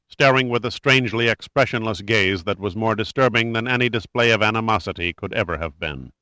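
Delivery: ordinary delivery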